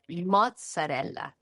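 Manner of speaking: "mozzarella" as MOTS-a-RELL-a; Italian pronunciation. Shown as a demonstration. Chef sums up the word's origin, "mozzarella" with full Italian vowels. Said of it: "Mozza being a bite".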